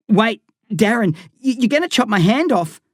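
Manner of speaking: frightened plea with a stutter; stammered. Acted. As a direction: stumble over the words in fear. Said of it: "I stammered".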